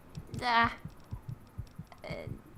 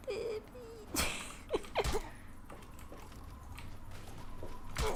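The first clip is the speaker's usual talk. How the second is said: breathless giggle; 10 semitones higher, 9 dB quieter than usual. Real, not acted. Giggling at failure.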